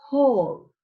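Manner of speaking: Paul with an explosive puff of air on the P; aspirated P at the start of Paul. Shown as a demonstration. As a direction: pop the P in Paul with a burst of air. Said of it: "This piece of paper moves".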